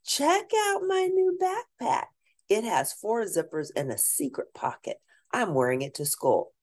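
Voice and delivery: a hint of a proud mom showing off; lightly voiced mom. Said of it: "said Mom".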